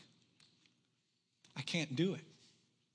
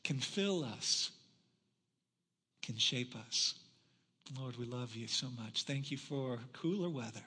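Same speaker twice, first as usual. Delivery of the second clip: soft, lowered sermon-closing prayer; earnest prayer; 7 semitones lower, 8 dB quieter than usual. Real, not acted. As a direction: pray quietly and humbly, leaving pauses. Closes in prayer.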